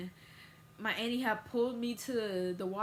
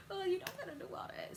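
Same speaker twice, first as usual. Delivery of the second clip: high, fast sarcastic taunt; mocking repeat; 12 semitones higher, faster than usual. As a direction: throw it back mockingly.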